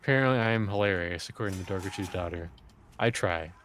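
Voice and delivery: dry deadpan delivery. Real, not acted. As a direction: say it utterly deadpan. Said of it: "You got the deadpan voice down".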